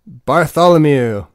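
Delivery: grand name. Acted. Announcing his alter ego's name.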